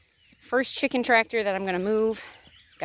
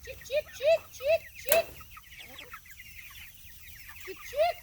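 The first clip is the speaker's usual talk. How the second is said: high repeated chick chick calls; calling chickens; 6 dB quieter than usual. Real, not acted. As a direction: coax the flock along in a high call. Calling chickens along behind tractor.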